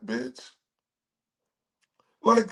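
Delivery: normal talking voice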